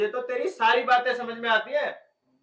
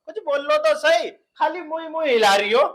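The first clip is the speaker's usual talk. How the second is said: raised voice, fed up and accusing; loud exasperated outburst; 3 semitones higher, 6 dB louder than usual. Acted. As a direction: shout at them to just say something. Son fed up, hears nothing they say.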